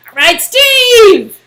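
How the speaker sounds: loud, slow, high cheer; cheering Steve on, loud and drawn out. Real, not acted. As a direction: whoop for your teammate. Cheering a teammate.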